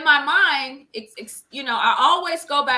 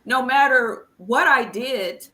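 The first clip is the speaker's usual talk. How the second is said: normal talking voice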